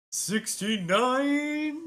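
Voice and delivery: slow, very high stretched-out sixty-nine; drawn-out sixty-nine. Pretend. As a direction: savor the number with a teasing lilt. Host announces episode sixty-nine jokingly.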